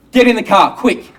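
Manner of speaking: high, loud urgent order; urgent shout. Acted. Husband rushing labouring wife to hospital, urgent high shout. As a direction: shout to hurry someone along.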